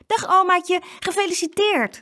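high, loud happy greeting; children calling out their congratulations; bright birthday shout from the kids; excited calling. A narrator's reading. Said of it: "riepen ze".

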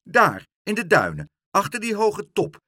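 high, loud gull voice; insistent directions. A narrator's reading. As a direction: call out the spot insistently. Leentje the gull gives directions. (nadrukkelijk, hoog en luid)

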